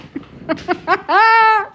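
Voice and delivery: high, pleased cackle; satisfied laugh. Real, not acted. Streamer laughing at the insult she sent the buyer, high cackle. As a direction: laugh out loud at your own burn. Laughing at her own comeback.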